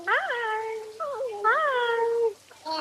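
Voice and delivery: high, very slow drawn-out hai; baby-talk hello. Shown as a demonstration. Mother showing her baby how to say hi, high and slow. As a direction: sing the hello slowly for the baby.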